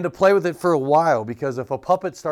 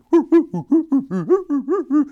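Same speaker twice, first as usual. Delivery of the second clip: loud high laugh; high burst of laughter; 14 semitones higher, 6 dB louder than usual. Real, not acted. Teacher laughing at the idea of a monkey-voiced puppet.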